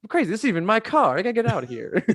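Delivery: high-pitched, agitated movie quote; hurried, high comic impression; Donnie Ostricki. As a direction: imitate a flustered man, voice pushed way up. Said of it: "he's upset and just annoyed by this".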